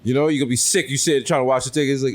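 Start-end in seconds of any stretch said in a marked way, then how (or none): none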